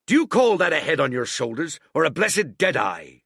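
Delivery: loud scolding cry; Long John scolding a sailor, loud; cried out. A narrator's reading. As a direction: berate him as a blockhead. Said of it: "cried Long John".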